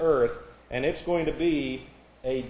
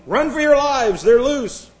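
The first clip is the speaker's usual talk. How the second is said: high, loud alarm; mock panicked yell; 11 semitones higher, 8 dB louder than usual. Preacher acting out a zoo prank, yelling in alarm. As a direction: yell it like the animals escaped.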